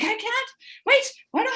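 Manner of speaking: high, flustered and lost; mock confusion. Mum lost at the controls, high flustered confusion. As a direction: fumble the words, totally confused.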